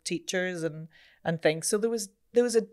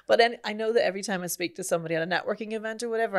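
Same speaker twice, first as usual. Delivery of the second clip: ordinary delivery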